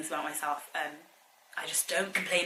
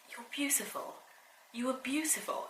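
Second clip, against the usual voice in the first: soft, tender whisper; mum's whisper; 7 semitones higher, 7 dB quieter than usual. Retold or imitated. Mum whispering to her daughter that she's beautiful. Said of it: "My mum was like".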